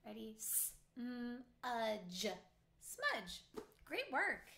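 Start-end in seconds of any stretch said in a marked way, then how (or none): 0.4-2.3 g-r-ate said sound by sound, slowly, each sound apart; chopped into sounds. Shown as a demonstration. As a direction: break g-r-ate into its separate sounds for the class. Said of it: "I'm going to chop it out loud".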